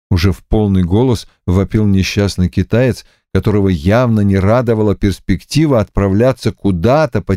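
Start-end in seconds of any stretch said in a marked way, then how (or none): none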